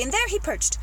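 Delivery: usual speaking voice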